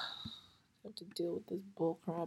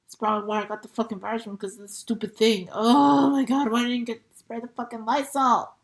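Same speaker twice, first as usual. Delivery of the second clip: loud, higher, fed-up complaint; exasperated rant; 3 semitones higher, 11 dB louder than usual. Retold or imitated. Complaining to her sister about catching the virus, loud exasperated rant. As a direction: rant in disbelief at her carelessness. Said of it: "I'm like".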